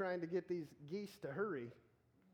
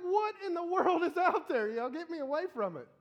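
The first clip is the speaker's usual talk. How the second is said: much higher, louder frightened yell; panicked cry; 11 semitones higher, 9 dB louder than usual. Pretend. Preacher acting a scared disciple seeing a figure, panicked yell. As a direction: shriek it like a terrified disciple. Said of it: "I'm like".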